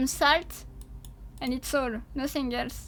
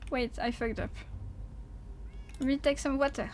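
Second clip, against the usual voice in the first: usual speaking voice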